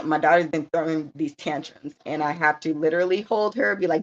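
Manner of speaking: ordinary delivery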